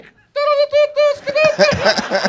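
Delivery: loud, very high phone jingle; ringtone imitation. The guy's phone keeps going while he is unfazed, ringtone imitation. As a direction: blare out a mock ringtone in nonsense syllables. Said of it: "this guy's phone just started ringing".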